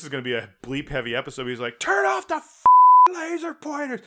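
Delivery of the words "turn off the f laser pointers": high, strained imitation of screaming; mock drunken scream. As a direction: scream it like an outraged drunk, not at full volume. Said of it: "And he starts screaming".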